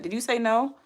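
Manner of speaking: ordinary talk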